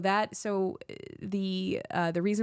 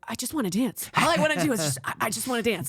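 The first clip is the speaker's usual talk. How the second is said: higher, louder giddy pleading; eager actor; 8 semitones higher, 4 dB louder than usual. Retold or imitated. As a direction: beg to dance like an overexcited actor. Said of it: "he's like".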